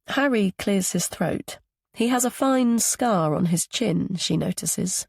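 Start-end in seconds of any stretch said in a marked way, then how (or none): none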